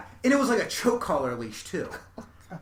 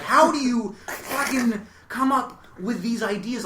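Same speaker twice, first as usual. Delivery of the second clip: slow, higher, incredulous question; exasperated disbelief; 8 semitones higher, 7 dB louder than usual. Retold or imitated. Quoting herself to her dad: slow exasperated disbelief. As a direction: drag it out in mock disbelief.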